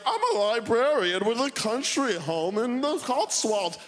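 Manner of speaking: a hint of a pleased old librarian; smug old person. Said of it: "every old person's like".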